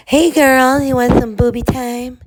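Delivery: high, loud, singsong invitation; put-on girly offer.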